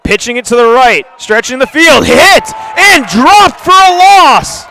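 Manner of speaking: rising to a loud, high yell on the hit; excited play call. Real, not acted. Play-by-play caller erupting as the ball carrier is dropped, excited yell. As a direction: sell the big stop. Big defensive stop called live.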